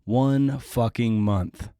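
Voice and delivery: ordinary talk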